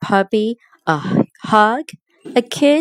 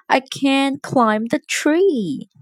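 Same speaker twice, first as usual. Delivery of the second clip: slow, higher-pitched little complaint; mild wail; 7 semitones higher, slower than usual. A narrator's reading. Puppy who can't pull the toy box, mildly wailing. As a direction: complain slowly with a touch of wail. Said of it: "wailed the puppy".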